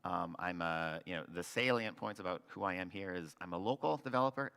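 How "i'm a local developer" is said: "local" lifted in pitch and punched; stress on LOCAL. Real, not acted. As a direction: lean hard on the word "local". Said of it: "emphasis on local".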